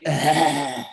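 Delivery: low chuckled sound effect; mock laugh effect. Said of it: "with sound effects".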